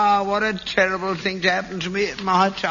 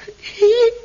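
high wheezy cackle of relief; high relieved cackle; 5 dB louder than usual. Real, not acted. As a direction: cackle high with glee at the news. Father laughs with relief.

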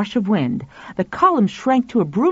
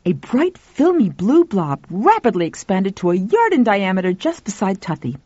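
plain reading voice

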